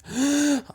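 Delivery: high choking gasp; mock strangled gasp. Shown as a demonstration. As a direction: gasp for air like you're being choked. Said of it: "It's not quite".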